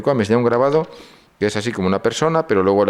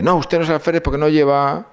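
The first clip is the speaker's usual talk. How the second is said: put-on high, tense young soldier refusing to believe the officer; a jumpy recruit blurting out his doubt, pitched high; nervous sentry; 8 semitones higher than usual. Trembling sentry tells the officer he is no alférez, high and tense. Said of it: "me apunta un tío temblando y dice".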